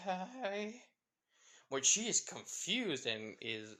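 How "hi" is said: high-pitched blurted greeting. A narrator's reading. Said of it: "she blurted out saying".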